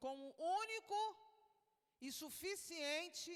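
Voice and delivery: "único" pushed high and loud, then "suficiente" drawn out slowly; emphatic prompting. Retold or imitated. Preacher prompting the man's confession, "único" and "suficiente" heavily emphasised.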